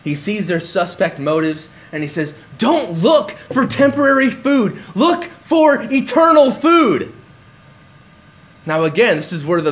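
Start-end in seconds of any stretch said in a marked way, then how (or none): 2.6-7.1 urgent exhortation. Retold or imitated. Paraphrasing Jesus' urging.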